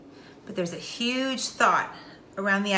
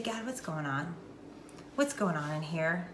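soft, low questioning of God; quiet prayer; 4 semitones lower, 9 dB quieter than usual. Acted. Person sitting still asking God what is wrong, hushed. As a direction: ask it softly and low, pausing between questions. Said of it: "you just sit there and be quiet".

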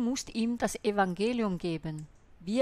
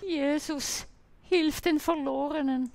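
higher, whiny imitation of a wailing believer; hammed-up lament, Jesus pitched high; mock wailing prayer; 7 semitones higher than usual. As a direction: mimic someone howling their prayer, much higher. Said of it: "da und heulst".

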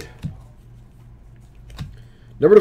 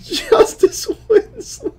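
slow, high, squeaky with laughter; laughing through a name; slower than usual. Real, not acted. Reading a card aloud while still laughing. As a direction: try to read the card and fail from laughing. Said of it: "I can't stop laughing".

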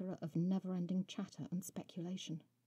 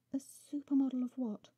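higher, louder startled retort; startled question; 7 semitones higher, 7 dB louder than usual. A narrator's reading. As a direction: blurt it out in surprise. Heroine answers, startled.

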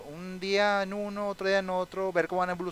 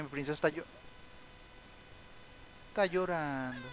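ordinary delivery

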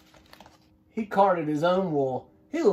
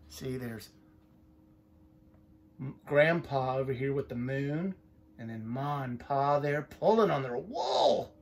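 usual speaking voice

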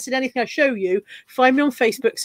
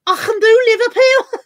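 much higher, louder failed Scouse; bad Liverpool accent; 10 semitones higher, 6 dB louder than usual. Shown as a demonstration. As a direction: overdo a squeaky Liverpudlian attempt. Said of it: "I can't do that either".

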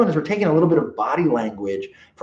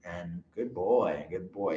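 soft praise; 6 semitones lower, 13 dB quieter, slower than usual. Shown as a demonstration.

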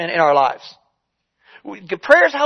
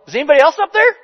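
mock yell, pitched higher; light comic call upward; retold yell; 5 semitones higher, much faster than usual. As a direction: toss the punchline up the cliff as a half-shout. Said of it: "and he yelled".

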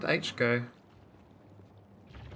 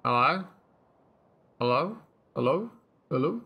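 short parroted hellos, each swooping upward; parrot-like repetition. Pretend. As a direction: squawk out greetings like a talking parrot. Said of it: "I'm like a parrot".

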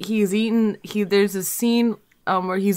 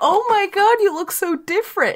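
retold amazement; 11 semitones higher than usual. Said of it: "'cause I'm like".

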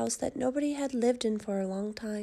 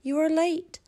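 high, loud pig taunt; lightly acted triumphant cry; 5 semitones higher, 5 dB louder than usual. A narrator's reading. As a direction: call it out the window, pitched up. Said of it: "looked out the window and cried".

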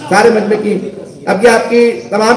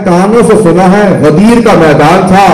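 preaching proclamation; 3 semitones higher, 5 dB louder than usual. Acted. Companion proclaims Ghadir to the crowd, building to full force. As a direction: build from firm declaration to high, loud cry.

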